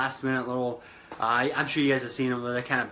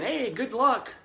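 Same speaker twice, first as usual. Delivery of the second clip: breezy sendoff; 7 semitones higher than usual. Retold or imitated. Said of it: "people are just sending them off saying".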